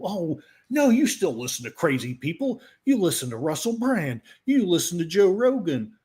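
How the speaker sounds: mock imitation of critics scolding; mocking retort. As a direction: throw their accusation back, hammed-up.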